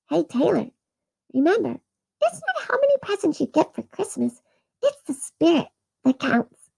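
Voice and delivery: a little angel imparting wisdom; tiny angel ornament speaking. Acted. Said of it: "angel ornament ... come to life".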